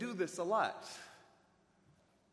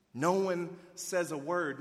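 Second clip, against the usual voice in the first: ordinary talk, nothing marked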